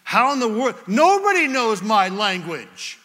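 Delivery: incredulous, as if it can't be true; mock astonishment. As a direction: play a mildly amazed bartender. Astonished bartender acted out in story.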